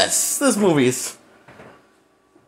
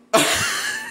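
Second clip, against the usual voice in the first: high squeaky laugh; 5 dB quieter than usual. Real, not acted. Disbelieving laugh at outcome.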